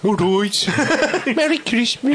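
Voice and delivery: hammed-up Wolverhampton accent, "all right" stretched high; Wolverhampton style. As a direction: greet the listeners like a broad Wolverhampton mate. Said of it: "in a Wolverhampton style".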